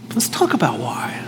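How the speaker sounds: God's gentle whisper to angry Cain. Acted. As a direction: whisper it as if leaning in close. God gently invites Cain to talk.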